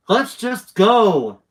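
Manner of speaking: loud, high, drawn-out plea; urgent shout, stretched on the last word; urgent pressing. A narrator's reading. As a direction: press them urgently to leave. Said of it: "Josh pressed".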